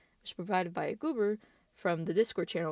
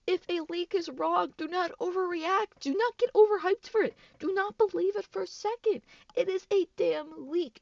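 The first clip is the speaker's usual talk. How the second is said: much higher and louder, each command hammered; angry lecture; 10 semitones higher, 5 dB louder than usual. Real, not acted. Vlogger loudly ordering the Dead by Daylight community to stop overreacting to leaks. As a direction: scold them like a fed-up parent.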